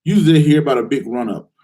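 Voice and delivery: normal talking voice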